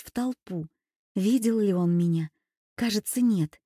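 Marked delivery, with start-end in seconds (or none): none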